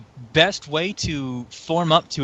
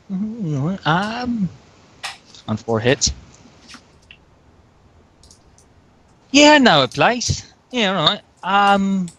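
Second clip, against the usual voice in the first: put-on Australian accent; 4 semitones higher than usual. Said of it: "what this accent sounds like".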